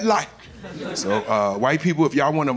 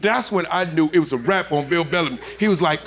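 normal talking voice

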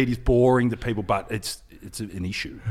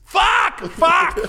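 much higher, loud frustrated yell; 7 dB louder than usual. Retold or imitated. Reporter swearing into the dying phone, a high loud yell. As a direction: blurt it out as the phone dies. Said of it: "the last thing he hears me say is".

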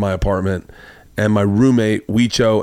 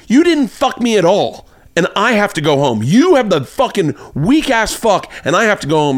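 retold angry tirade; 9 semitones higher than usual. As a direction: imitate her fuming, leaning on the words. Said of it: "she immediately gets angry".

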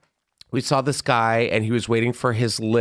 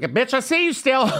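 mock taunt; 13 semitones higher than usual. Taunting the fart-shamer in imagination.

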